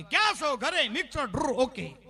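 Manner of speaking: loud, high call, rapid-fire exchange; loud phone check. Retold or imitated. Wife checks on husband at night, loud high exchange. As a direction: rattle off the routine at full volume.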